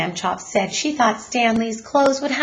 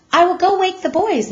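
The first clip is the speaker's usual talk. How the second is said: put-on higher, brighter mom voice calling the boys; a cheerful mother announcing she'll wake them; mother's voice; 12 semitones higher, 6 dB louder than usual. A narrator's reading. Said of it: "Missus Lambchop said".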